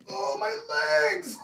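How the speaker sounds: very high, drawn-out cry; hammed-up squeak of pain; pained wail. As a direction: squeal about aching limbs. Complains of legs getting up.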